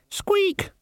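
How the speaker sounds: very high-pitched squeak; piercingly high rodent voice; rat squeak. A narrator's reading. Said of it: "The Death of Rats squeaked".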